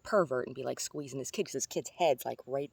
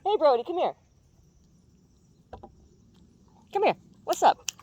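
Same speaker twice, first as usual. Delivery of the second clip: much higher, louder pet-talk voice; talking to the dog; 13 semitones higher, 7 dB louder than usual. Real, not acted. Calling the dog over.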